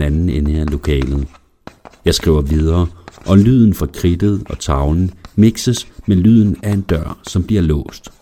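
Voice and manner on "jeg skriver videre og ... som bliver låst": ordinary delivery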